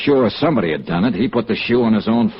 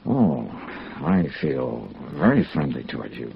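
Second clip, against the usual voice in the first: slow, soft flirting; 9 semitones lower, 7 dB quieter, slower than usual. Acted. Flirting with Ellen.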